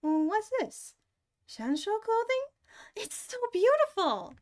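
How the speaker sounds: put-on high, bright girlish voice; March voice. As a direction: pitch way up like a young girl. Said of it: "March says".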